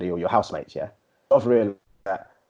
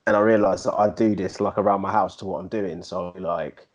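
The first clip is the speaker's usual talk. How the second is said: ordinary talk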